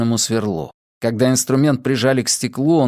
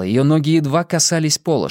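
ordinary delivery, nothing marked

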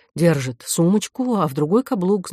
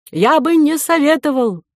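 high raised voice, calling after someone; shouted warning; 9 semitones higher, 4 dB louder than usual. A narrator's reading. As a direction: shout a warning at departing backs. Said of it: "закричал вслед портье". (предостерегающий крик вслед)

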